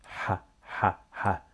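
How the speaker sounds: "ha" with throaty Arabic ح, in separate short bursts; Arabic ḥa sound. Shown as a demonstration. Teacher showing learners the letter, "ha" as a throaty ح. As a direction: repeat "ha" with the h made in the throat. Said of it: "the sound here is this letter".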